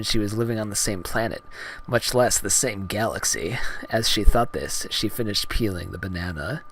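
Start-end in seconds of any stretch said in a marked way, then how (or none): none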